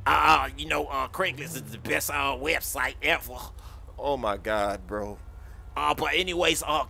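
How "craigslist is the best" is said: normal talking voice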